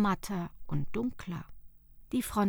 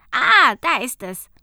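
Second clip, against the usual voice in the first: much louder pleased discovery; found it; 3 semitones higher, 15 dB louder than usual. Acted. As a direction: burst out with relief at finding it. Finds the page. (Arvid ruft erfreut)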